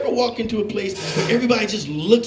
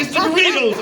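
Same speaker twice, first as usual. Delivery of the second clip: higher, loud comic blurt; mock yell; 8 semitones higher, 4 dB louder than usual. Fleeing the aisle, yelling an excuse back at the lady. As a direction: shout the excuse over your shoulder.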